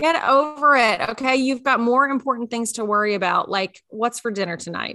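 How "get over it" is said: slow, high, louder GET; slow emphatic Get over it; emphatic echo. Acted. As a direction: hit Get and drag it out. Echoing the retort.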